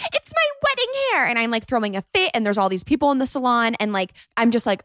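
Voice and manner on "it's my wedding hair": shrill, much higher mock bridezilla wail; crazy bride. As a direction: whine it like a bratty bride. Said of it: "be this crazy bride that's like".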